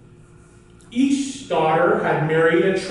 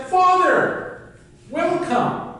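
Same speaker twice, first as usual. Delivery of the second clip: much higher, bright welcome; delighted daughter; 12 semitones higher, much slower than usual. Acted. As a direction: greet your father high and happy. Second daughter greets her father.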